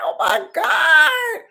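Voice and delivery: high, loud cry of disbelief, slowing on oh my God; shocked cry. Retold or imitated. Reader reliving her shock at the client's yelling, loud and high. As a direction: wail it out, loud and drawn out. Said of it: "brought out that much".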